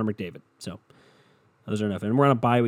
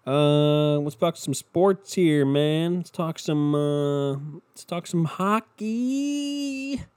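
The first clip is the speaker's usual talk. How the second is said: slow, higher announcer voice, hockey drawn way out; hammed-up announcer intro; mock sports host; 4 semitones higher, much slower than usual. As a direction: hype it up like a sports radio host.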